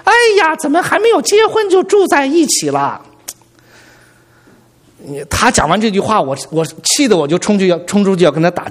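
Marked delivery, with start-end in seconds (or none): 0.0-3.0 high, shrill-free mock scold, pitched way up; loud scolding coworker. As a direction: shriek it like a nosy coworker catching them out. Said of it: "特别大声地说".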